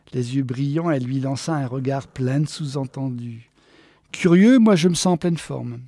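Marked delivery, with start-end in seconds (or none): 4.1-5.8 loud, breezy contradiction; perky. Acted. Geneviève answers tired Walter, perky. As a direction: brush off his excuse brightly.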